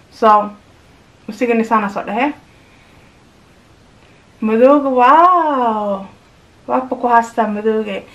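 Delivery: muffled behind fabric mask; speaking with mouth covered; through a cloth face mask. Real, not acted.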